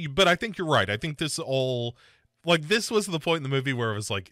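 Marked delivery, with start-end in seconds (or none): none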